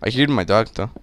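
ordinary talk

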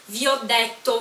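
ordinary talk, nothing marked

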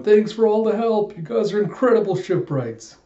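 hammed-up, high-pitched gratitude; grateful cheer. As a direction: gush thanks as an acted-out crowd. Carpenters thanking, acted out.

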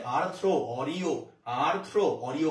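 আর্থ্রো, Oreo spaced out slowly, each word set apart; slow memory drill. Shown as a demonstration. Teacher drilling a memory trick for students, slowly. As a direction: drill the pair slowly so it sticks. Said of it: "দেখো কিভাবে মনে রাখবা".

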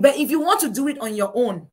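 normal talking voice

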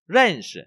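"rần" stressed, "shi" light. Shown as a demonstration. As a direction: lean on "rần", skim "shi". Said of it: "đọc như vậy".

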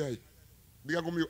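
normal talking voice

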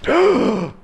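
sharp, high gasp. Real, not acted. Player reacting to the game, gasping. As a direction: draw in a startled breath.

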